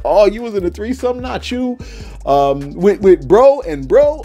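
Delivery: high, drawn-out incredulous gasp of a question; mock shocked disbelief. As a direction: act scandalized, stretch out bro and bro. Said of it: "I was like".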